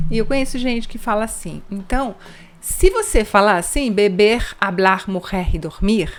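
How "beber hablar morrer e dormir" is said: "beber, hablar, morrer e dormir" loud with throaty French R; teacher mocking a French-like R; French-sounding R. Shown as a demonstration. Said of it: "vão pensar que você é francês".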